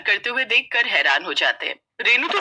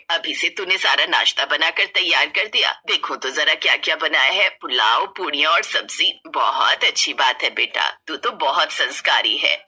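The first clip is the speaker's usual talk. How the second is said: lower-voiced proud mother-in-law; pleased mother-in-law; 6 semitones lower than usual. Mother-in-law praises the new bride.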